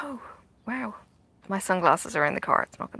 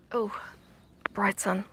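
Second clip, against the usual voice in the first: normal talking voice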